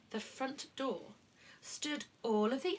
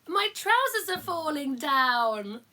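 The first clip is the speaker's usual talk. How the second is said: gasping alarm; 6 semitones higher, 7 dB louder than usual. Pretend. Giant realising his trousers are falling down. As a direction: gasp then slow, louder, higher panic.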